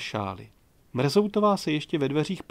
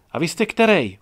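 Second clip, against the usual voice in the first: louder, higher barked question; hooted; 4 semitones higher, 6 dB louder than usual. A narrator's reading. Gajdošíková shouting down from the window, hooted question. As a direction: call it out curtly. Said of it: "houkla Gajdošíkova choť".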